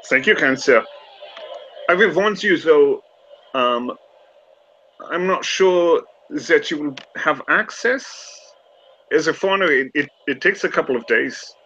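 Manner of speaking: a courteous French gentleman; French accent. Acted. Remy warning the Irishman about access, French accent. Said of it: "I keep going German".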